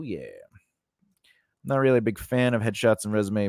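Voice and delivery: normal talking voice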